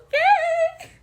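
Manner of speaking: high squeaky okay into giggles; losing it completely; embarrassed squeal and laugh; flustered giggle. Real, not acted. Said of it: "I can't keep my composure".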